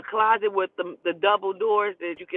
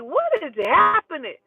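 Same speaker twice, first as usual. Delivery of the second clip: high, loud, stunned "what is happening"; shocked disbelief; 8 semitones higher, 4 dB louder than usual. Retold or imitated. To her friend mid-fight, shocked and pitched high. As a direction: caught off guard in a play fight gone wrong. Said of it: "I'm like".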